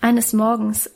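ordinary delivery, nothing marked

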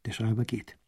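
usual speaking voice

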